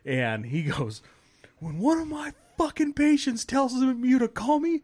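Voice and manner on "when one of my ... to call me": much higher, louder mock yell; furious outburst. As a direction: explode at the nurse, higher and louder. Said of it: "he exploded".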